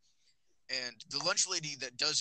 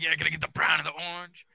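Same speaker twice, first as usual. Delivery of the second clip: quick cheeky order, pleased with himself; 3 semitones higher, 6 dB louder, faster than usual. Retold or imitated. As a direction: rattle it off fast and proud. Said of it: "I'm like".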